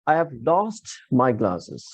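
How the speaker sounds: slow sentence with "lost" punched; stress on LOST. Shown as a demonstration. Teacher quizzes students on accented word, stressing "lost". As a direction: make "lost" stand out from the rest, slowly. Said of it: "No, lost".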